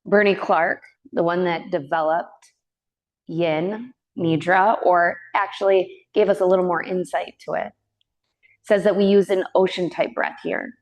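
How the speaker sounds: calm, unhurried yoga teacher voice with long pauses; even, measured breath-awareness cueing; guided meditation. Real, not acted. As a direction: gently guide them into the breath.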